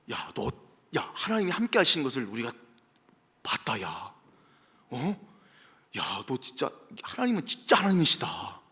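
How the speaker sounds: low, soft pleading, partly whispered; hushed pleading. Acted. King pleading with Isaac, low hushed voice. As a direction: plead in a hush.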